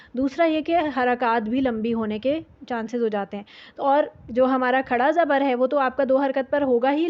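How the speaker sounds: normal talking voice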